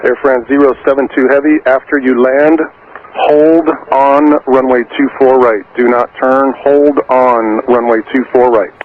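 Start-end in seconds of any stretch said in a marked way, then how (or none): none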